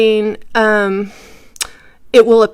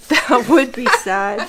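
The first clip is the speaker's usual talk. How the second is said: words breaking into laughter; laughing; 2 semitones higher than usual. Real, not acted. Presenter joking about backwards designs, laughing. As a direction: say it through a real laugh.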